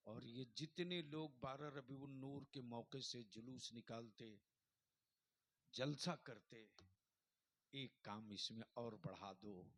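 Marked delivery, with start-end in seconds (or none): none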